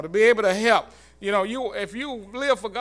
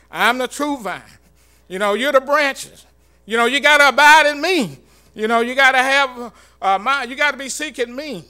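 raised, louder preaching of Jesus' call; preaching Jesus' words; 5 semitones higher, 10 dB louder than usual. Acted. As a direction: proclaim it as Jesus calling out to the crowd.

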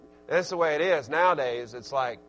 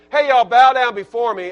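mock proud command; 4 semitones higher, 13 dB louder than usual. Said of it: "He wasn't saying".

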